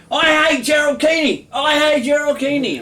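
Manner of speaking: high, loud drawn-out heckle; imitated audience yell. Poet imitates audiences yelling out at poetry readings, high mock shout. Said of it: "they yell out".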